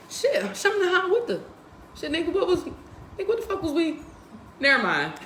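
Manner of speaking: high, baffled mock mumbling; mock confused self. Imitating herself forgetting what upset her, high confused voice. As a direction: play lost, forgetting the grudge.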